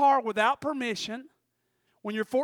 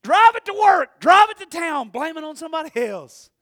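inner shout; 9 semitones higher, 13 dB louder than usual. Retold or imitated. Teen silently ranting that he wanted her to blame someone. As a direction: shout it in your head, exasperated. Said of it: "thinking in my mind".

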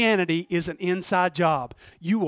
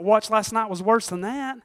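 normal talking voice

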